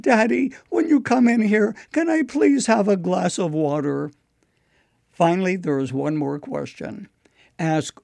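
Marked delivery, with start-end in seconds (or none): 0.0-4.1 put-on a higher, resigned small boy; resigned little voice. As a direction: give in, but still ask like a little boy. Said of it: "a resigned little voice said".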